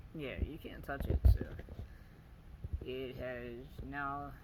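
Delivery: ordinary delivery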